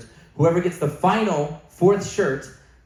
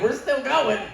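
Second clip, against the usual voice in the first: gleeful; 8 semitones higher, slower than usual. Retold or imitated. Doubling down on the prank.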